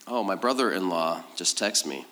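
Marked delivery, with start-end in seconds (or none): none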